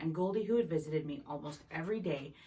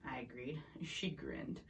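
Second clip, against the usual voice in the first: ordinary delivery, nothing marked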